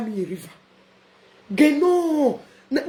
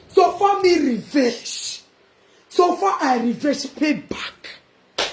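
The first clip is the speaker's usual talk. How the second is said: slow gloating; much slower than usual. Real, not acted. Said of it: "drawn-out gloating".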